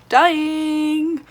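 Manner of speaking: high, loud, drawn-out squeal; gushing cry. Real, not acted. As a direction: belt it out in delighted agony. Gushing over the golden shade.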